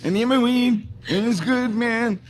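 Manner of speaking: high, loud burnout whine; Ralph the Cat. Acted. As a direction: push it up high like a nervous stoner needing a fix. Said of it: "he had his voice".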